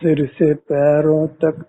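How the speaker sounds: usual speaking voice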